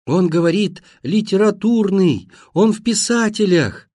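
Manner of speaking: high, sustained relay cry; thin, high-pitched calling; piercing thin shout. A narrator's reading. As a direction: shout it shrilly into a deaf ear. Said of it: "опять прокричала пронзительным, тоненьким голосом".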